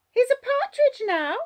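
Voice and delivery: put-on high, loud child explaining; Jania. A narrator's reading. Child explaining to groggy Wallace, high child voice. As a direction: explain it as a little girl. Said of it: "Jania is explaining".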